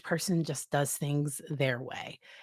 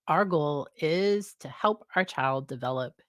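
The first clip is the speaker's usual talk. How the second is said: ordinary talk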